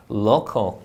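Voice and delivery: low "local" with a clean L; touch tongue behind teeth for "local"; clear L. Shown as a demonstration. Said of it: "혀가 앞니 뒤쪽에 붙죠". ("local"의 L을 정확히 발음)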